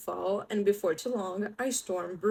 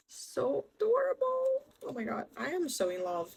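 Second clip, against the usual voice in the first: squealing with delight, very high; gushing; 5 semitones higher than usual. Real, not acted. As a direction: gush over it, pitched way up. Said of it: "gushing over the title".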